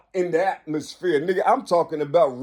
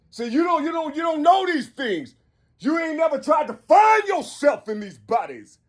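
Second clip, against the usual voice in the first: preaching at full force; 10 semitones higher than usual. Real, not acted. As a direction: cry it out as a fiery prophet. Preaching shout continues.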